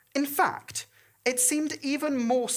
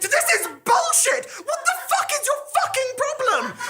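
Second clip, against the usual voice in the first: very high, loud laughing shriek; 4 dB louder than usual. Acted. Thomas after the unicorn gores him, hysterical laughing shriek. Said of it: "Thomas shrieked".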